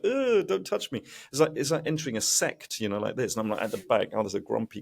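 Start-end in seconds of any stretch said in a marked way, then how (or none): 0.0-1.0 high, recoiling mock disgust. Newcomer recoiling from the crowd, mock disgust. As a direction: shrink away in mock revulsion. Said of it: "You're like".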